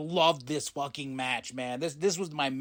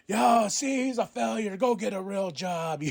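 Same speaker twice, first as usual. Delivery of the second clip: high, louder taunting voice; mocking Don Callis; 7 semitones higher, 4 dB louder than usual. Don Callis mocks the loser, taunting and high. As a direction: sneer it like a heel manager.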